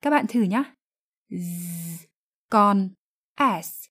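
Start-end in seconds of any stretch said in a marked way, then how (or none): 1.3-2.0 Z as a long, low, soft buzz; voiced Z sound. Shown as a demonstration. Teacher invites learners to try, Z hummed with voice. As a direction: sustain Z so the throat vibrates. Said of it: "âm Z là phụ âm hữu thanh".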